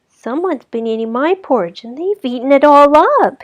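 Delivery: high, rising to loud little cub; teeny tiny voice. Acted. Baby Bear wails over his empty bowl, high and tiny. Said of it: "in his teeny tiny voice".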